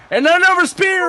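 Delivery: high, loud excited call; excited shout. Real, not acted. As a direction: scream the move name. Commentator calls the big move.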